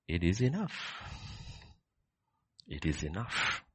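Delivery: usual speaking voice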